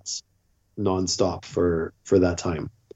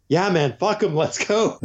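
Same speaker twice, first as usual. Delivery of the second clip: eager agreement; 8 semitones higher than usual. Retold or imitated. Eager reply to friend's plan.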